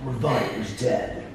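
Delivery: lightly voiced movie trailer narrator; horror trailer voice. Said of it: "That's a pretty good voice".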